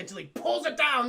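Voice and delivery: usual speaking voice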